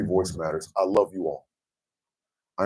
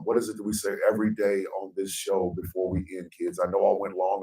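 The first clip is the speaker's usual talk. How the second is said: ordinary talk, nothing marked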